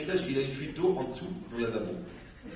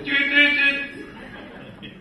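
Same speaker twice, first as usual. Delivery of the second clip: high loud beeping tut tut; alarm beep imitation; 10 semitones higher, 5 dB louder, slower than usual. Rabbi mimicking the security alarm, high beeps. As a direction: beep like an airport gate.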